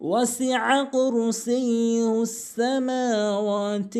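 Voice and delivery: وسع كرسيه السماوات chanted slowly, the mim and waw held one beat; one-count madd. Shown as a demonstration. Teacher demonstrating the short madd while chanting the verse. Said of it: "এক আলিফ টেনে".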